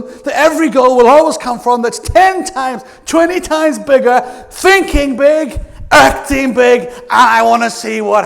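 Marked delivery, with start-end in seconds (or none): 0.0-6.8 loud, rising rallying cry; excited. Real, not acted. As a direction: fire up the audience. Rousing the crowd.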